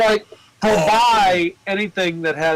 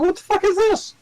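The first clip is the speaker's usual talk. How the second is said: mock shocked outburst. Retells shock at a [name] photo.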